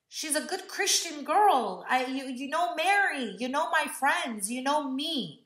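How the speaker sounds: high, pleading voice; begging. Retold or imitated. Begging mom to let her go.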